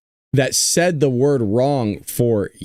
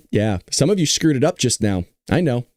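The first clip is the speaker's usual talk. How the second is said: normal talking voice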